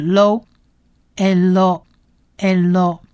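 "lo，elo，elo" spelled out slowly, syllable by syllable; syllable demo. Shown as a demonstration. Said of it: "Lamed的了加上O是lo". (慢速拼读elo)

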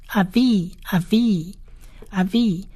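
"阿V" as ah-vee, long final ee; Hebrew word demo. Shown as a demonstration. Teacher modelling the word for father, careful "阿V". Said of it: "是长义的音".

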